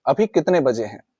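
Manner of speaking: usual speaking voice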